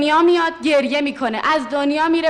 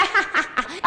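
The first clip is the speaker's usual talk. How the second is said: soft laugh; 6 dB quieter than usual. Real, not acted. Laughing at the absurd test.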